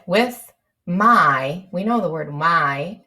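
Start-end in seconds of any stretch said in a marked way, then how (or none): none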